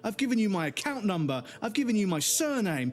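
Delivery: high-pitched, fed-up outburst at a useless helpline; pissed off. Retold or imitated. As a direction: reel off each point in mounting frustration. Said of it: "I'm still pissed off about it".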